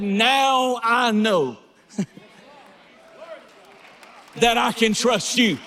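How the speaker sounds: full-throated preacher building to the climax; preaching at full force. Acted. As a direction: preach it like the church is on its feet.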